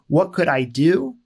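normal talking voice

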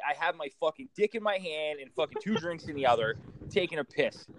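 normal talking voice

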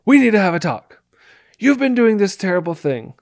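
aggressive demo voice. Shown as a demonstration. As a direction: confront them like a scolding boss. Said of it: "You can't start talking like this".

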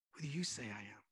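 very soft, low and intimate; a private, probing hush; hushed question. Acted. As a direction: repeat it almost under your breath. Question repeated almost in a hush.

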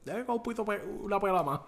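laughing high-pitched quip; lightly voiced cornerman; high mock quote. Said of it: "uno dijo". (imitando al esquinero riendo)

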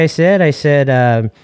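normal talking voice

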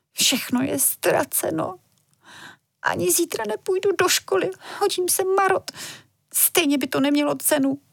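put-on a girl fighting back tears; close to tears. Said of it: "do očí se jí tlačily slzy". (vysoko, tiše, se slzami na krajíčku)

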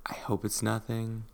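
ordinary talk

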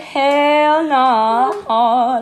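high, loud, drawn-out mock refusal; emphatic refusal. Imitating scared brother refusing to come, emphatic. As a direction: stretch it out in hammed-up alarm.

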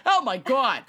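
replayed mock exclamation in New York accent; attempted New York accent. Host repeating the wife's quote, attempted New York accent. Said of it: "she shouted".

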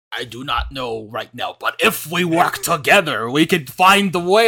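slow, put-on scheming emperor voice; mock Palpatine. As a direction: slowly drawl it out like a sly Chancellor. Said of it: "and he's like".

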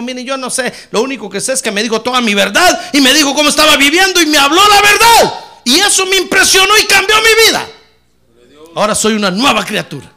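rising to a loud, high shout; excited testimony. Acted. Samaritan woman telling the townsmen, excitedly shouting. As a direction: build from quick telling to a ringing cry.

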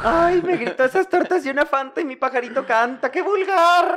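agudo y exagerado, muy quejumbroso; voz de chica quejumbrosa. Retold or imitated. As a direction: queja chillona y fingida. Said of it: "Y es como". (voz aguda de chica escandalizada)

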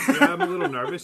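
high, loud, laughing while quoting himself; laughing through it. Retold or imitated. Husband laughing as he retells telling the nurse he's nervous. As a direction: giggle out the confession.

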